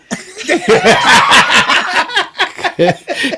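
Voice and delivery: loud, high burst of laughter; big laugh. Real, not acted. Man laughing hard at his own story, a big loud laugh. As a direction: crack up at the punchline.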